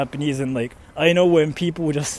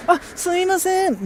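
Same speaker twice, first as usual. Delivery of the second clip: much higher polite Japanese voice; demonstrating a high Japanese "excuse me" voice; high-pitched Japanese voice; 13 semitones higher than usual. Shown as a demonstration. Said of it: "speaking in a high to-, high-pitched voice".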